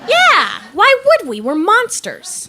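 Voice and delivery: loud, high-pitched exuberance; gleeful agreement. Acted. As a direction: crow it like a proud new monster. Gleefully agrees monsters skip bills.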